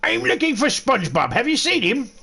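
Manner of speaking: squeaky cartoonish caller voice; high silly prank voice. Prank caller asks a stranger for SpongeBob, squeaky cartoon voice. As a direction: play a high-voiced stranger hunting for a lost friend.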